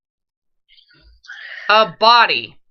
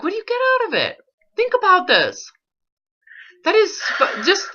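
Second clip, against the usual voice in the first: much higher, fast and urgent; exasperated high push; 10 semitones higher than usual. Real, not acted. Coach pressing client, exasperated, much higher and faster. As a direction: leap up in pitch, pressing hard.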